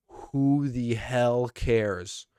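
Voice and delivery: slow, drawn-out dismissive question; exasperated. Real, not acted. Fan ranting about a cringy gimmick, slow and exasperated. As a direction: stretch it out in disbelief.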